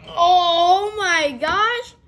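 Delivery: slow loud high wail; agonized wail. Real, not acted. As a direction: drag it out in agony. Sour candy pain.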